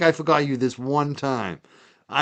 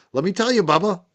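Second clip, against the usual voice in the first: higher-pitched Byfield Bubba accent; 6 semitones higher than usual. Shown as a demonstration. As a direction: give a burst of Byfield village accent. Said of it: "the Byfield Bubba accent that I grew up with".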